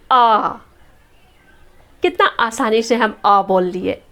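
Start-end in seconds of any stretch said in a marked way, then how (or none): 0.1-0.5 "आ" as one long open vowel; pronunciation demo. Shown as a demonstration. Teacher shows how easily "आ" is said, sustained demo. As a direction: sound out "आ" alone, held steadily. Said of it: "हम बोलते हैं".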